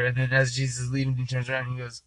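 normal talking voice

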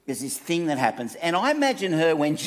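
ordinary talk

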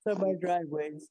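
talking through a yawn; words stretched by a yawn. Real, not acted. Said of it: "you're yawning too".

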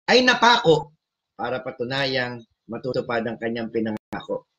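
ordinary delivery

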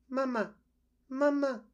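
very high tiny peeping voice; tiny peeping gosling. Acted. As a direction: peep it like a small chick in an egg. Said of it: "peeped a tiny, muffled voice".

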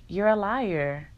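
slow, deliberate callout; blunt accusation. Retold or imitated. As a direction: lay each word down as an accusation. Calling out a coworker.

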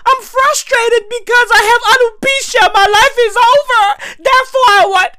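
very high, squealing mock lament; mocking Jada. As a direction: wail the excuse up high, making fun. Mocks Jada's excuse.

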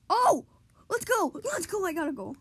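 high, loud, excited cheer; goal celebration. Real, not acted. Gamer cheering their own goal, excited.

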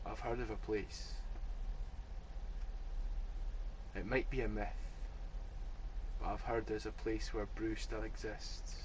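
hushed noir shopkeeper; speakeasy mystery. Retold or imitated. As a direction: whisper a secret legend. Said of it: "nineteen thirties New York speakeasy".

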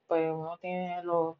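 ordinary delivery

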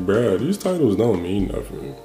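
ordinary talk, nothing marked

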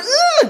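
high-pitched squeal of fright; mock-terrified wordless squeak; frightened "ugh". Saul terrified before God, high mock squeal. Acts Saul's terrified reaction.

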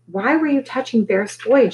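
high, accusing demand; mildly barked accusation; barked question. A narrator's reading. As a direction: demand an answer, indignant. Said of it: "she barked".

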